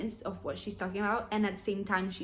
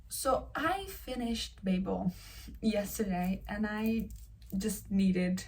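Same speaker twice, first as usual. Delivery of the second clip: ordinary talk, nothing marked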